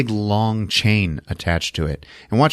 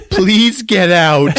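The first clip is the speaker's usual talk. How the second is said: mock plea; 9 semitones higher, 5 dB louder, much slower than usual. Acts out her desperate plea.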